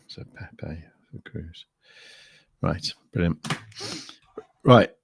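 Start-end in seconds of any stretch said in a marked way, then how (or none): 0.1-1.7 whisper. Real, not acted. Reading chat quietly to himself.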